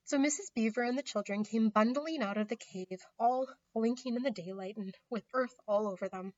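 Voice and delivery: usual speaking voice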